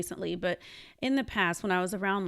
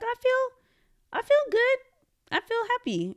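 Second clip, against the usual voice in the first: much higher, giddy and dreamy; 4 dB louder than usual. Retold or imitated. Telling boyfriend how she feels, giddy and high. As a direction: float up on every feel, blissed out. Answering boyfriend while kissing.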